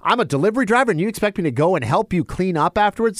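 delivery drivers asked to clean, high mock outrage; indignant drivers. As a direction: drag out "hell no" in disbelief. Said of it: "everybody was like".

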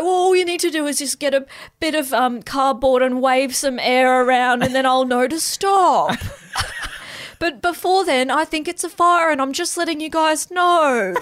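high, laughing alarm voice; chatty fire alarm. Acted. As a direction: play the alarm, amused and chatty. Voicing the alarm.

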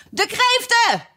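very high excited shriek; Gryphon's high, acted scream; shouting. A narrator's reading. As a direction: burst in with a high-pitched cry. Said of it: "schreeuwde de Griffioen".

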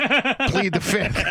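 laughing it off. Real, not acted. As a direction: plead the fifth through a laugh. Joking evasion, laughing.

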